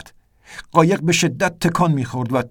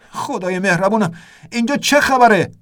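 rising, louder shout of alarm over the storm; shouted question; 7 semitones higher, 3 dB louder than usual. A narrator's reading. Narrator shouting to Ernest over the storm, alarmed. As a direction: yell it across the cabin in panic. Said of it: "به طرف ارنست فریاد زدم".

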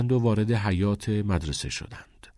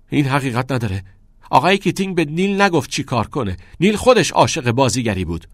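raised, higher-pitched contradiction; emphatic, upset rebuttal; heated protest; 6 semitones higher, 6 dB louder than usual. A narrator's reading. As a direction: insist firmly against the accusation. Protests in defence of teacher.

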